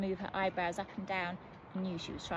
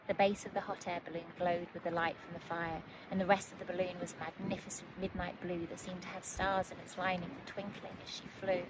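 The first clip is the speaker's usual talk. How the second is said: usual speaking voice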